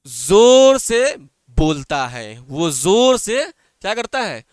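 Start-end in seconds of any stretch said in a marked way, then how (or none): 0.1-2.4 louder and slow, showing what loudly means; teacher acting out loudly while explaining it. Shown as a demonstration. Teacher shows pupils what loudly means, loud and slow.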